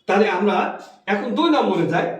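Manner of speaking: ordinary talk, nothing marked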